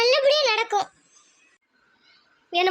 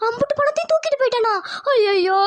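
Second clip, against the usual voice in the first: shocked wailing; 4 semitones higher than usual. Acted. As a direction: cry out in horror at the loss. Relative hears money was stolen. (அதிர்ச்சியில் அலறிப் புலம்பல்)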